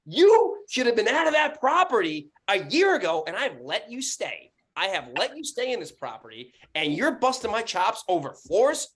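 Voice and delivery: indignant high-pitched rant; pissed off. Retold or imitated. Landlord retells scolding his tenant, angry outburst. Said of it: "And now I'm fucking pissed".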